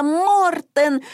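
high falling cry of the name; one voice standing in for everyone exclaiming; exclaiming in chorus. A narrator's reading. All the children echoing the name, high exclamation. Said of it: "воскликнули все хором".

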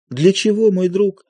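pained tearful outburst; crying out in grief. A narrator's reading. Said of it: "Любовь Андреевна тихо плачет".

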